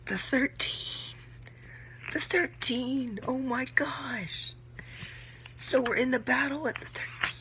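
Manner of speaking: high, slow, breathy whisper through tears; barely holding it together; tearful whisper. Real, not acted. Reacting to the thirteen's sacrifice, a slow tearful whisper. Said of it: "tears welling up in my eyes".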